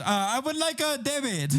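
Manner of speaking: put-on high, copied Chinese accent; matching Chinese accent. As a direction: mirror the cashier's accent. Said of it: "and he goes".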